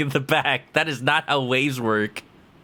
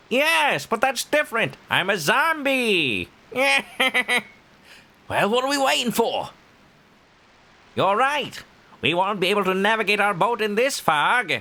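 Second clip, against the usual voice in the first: higher character voice; 8 semitones higher than usual. Acted.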